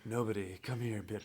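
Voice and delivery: low, soft seductive growl; seductive Batman. Retold or imitated. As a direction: pull her in quietly. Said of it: "he's like".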